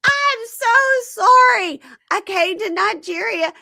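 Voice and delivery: very high, loud hammed-up sobbing; mock crying plea. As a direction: wail the apology on your knees.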